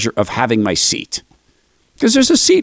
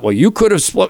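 mock quarrel, pitched higher; retold parking-lot argument; 7 semitones higher than usual. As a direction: snap back at the other driver, half acted. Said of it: "So I argued with him".

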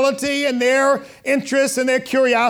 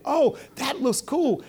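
slow, dreamy mock gush; imitating a dazzled kid, slowed right down; mock admiration, drawn out; 3 semitones higher, 4 dB quieter, slower than usual. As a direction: stretch it like a smitten follower. Mocking peer-driven kids.

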